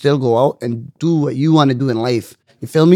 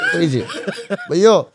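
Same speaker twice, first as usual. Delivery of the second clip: laughing; 5 semitones higher than usual. Real, not acted. Laughing at own joke.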